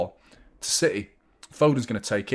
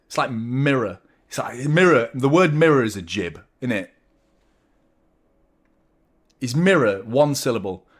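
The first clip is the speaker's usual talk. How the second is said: normal talking voice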